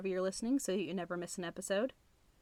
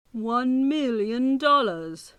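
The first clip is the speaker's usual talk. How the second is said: movie villain voice; 4 semitones higher, 13 dB louder, much slower than usual. Retold or imitated. Quoting the famous villain line.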